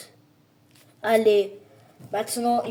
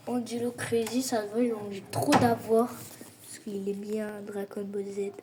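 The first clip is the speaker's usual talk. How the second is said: normal talking voice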